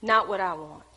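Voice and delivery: bow your head and pray quietly; humble prayer. Retold or imitated. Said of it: "I bowed my head".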